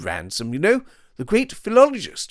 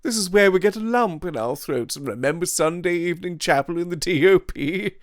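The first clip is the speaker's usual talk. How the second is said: mocking Devine; 12 semitones higher than usual. A narrator's reading. Devine mocks school sentiment.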